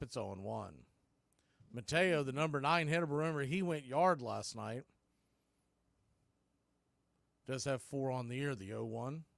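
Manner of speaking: steady radio call, pitch by pitch; live play-by-play. Real, not acted. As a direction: call each pitch to the listeners.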